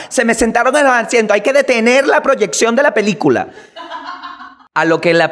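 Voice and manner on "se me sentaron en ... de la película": high, indignant and demanding, half-laughing; mock the woman demanding they stop the film; whiny outraged moviegoer, hammed up; pushy entitled lady. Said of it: "llega esta tipa".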